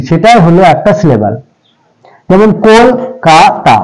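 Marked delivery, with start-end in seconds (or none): 2.7-3.8 Kolkata drawn out as কোল, কা, তা; syllable by syllable. Shown as a demonstration. Teacher giving an example syllable count, Kolkata slowly divided. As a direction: say Kolkata slowly in three beats. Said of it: "একবারে কোল".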